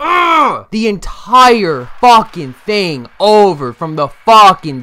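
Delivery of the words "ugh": loud high groan of defeat; frustrated shout. Real, not acted. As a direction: let out an angry yell. Frustrated groan after dying.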